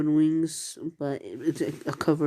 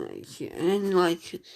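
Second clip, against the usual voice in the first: normal talking voice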